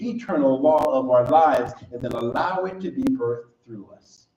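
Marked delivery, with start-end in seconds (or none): none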